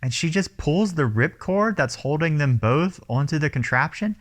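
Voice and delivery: normal talking voice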